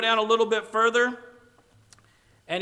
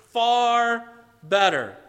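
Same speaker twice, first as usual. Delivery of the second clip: far better, drawn out slow and loud; stressed far; 3 semitones higher, 5 dB louder, much slower than usual. Acted. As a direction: lean hard on far, stretching both words. Preacher dwells on the quote.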